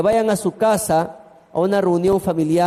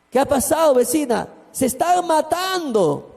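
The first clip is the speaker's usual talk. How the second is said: panicked neighbour; 8 semitones higher than usual. Retold or imitated. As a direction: tell him they are killing each other, frantic. Neighbour's frantic report.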